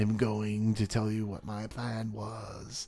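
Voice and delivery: slow, drawn-out villain reveal; villain monologue. Pretend. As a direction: ham up the crazy villain plan. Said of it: "that villain kind of thing".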